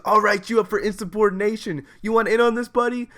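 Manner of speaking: loud, high-pitched mock tough-teacher challenge; badass teacher. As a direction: imitate a teacher barking a cocky threat. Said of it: "like she's a fucking badass and goes".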